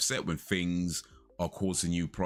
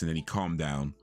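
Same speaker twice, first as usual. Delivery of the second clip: usual speaking voice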